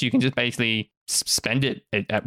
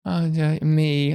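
higher-pitched moaning in a dream; sleep-talking dreamer; 5 semitones higher, slower than usual. Acted. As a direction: act out someone fretting in their sleep. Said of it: "he falls asleep and there's like".

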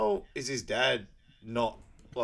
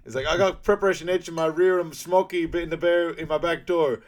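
higher, louder hammed-up radio talk; mock CB trucker; 6 semitones higher, 8 dB louder than usual. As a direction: bark it like a trucker on the CB. Acting trucker radio chatter.

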